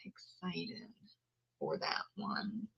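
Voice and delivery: "Excited" murmured almost silent; barely voiced. Real, not acted. Vlogger repeats her excitement under her breath.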